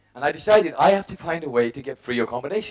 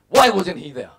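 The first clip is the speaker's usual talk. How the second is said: louder, higher indignant question; mildly acted angry outburst; retold anger; 3 semitones higher, 6 dB louder than usual. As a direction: relive the resentment, raising your voice. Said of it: "I was so angry".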